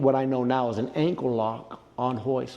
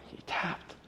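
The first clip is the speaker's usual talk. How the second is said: hushed whisper; 13 dB quieter than usual. Retold or imitated. Friends watching the fight in awe.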